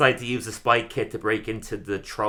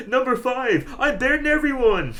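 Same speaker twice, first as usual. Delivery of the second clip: much higher, louder voice, laughing through it; gloating mock voice; 5 dB louder than usual. Homer bragging about making top five, high laughing gloat. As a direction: crow about the win like a smug cartoon.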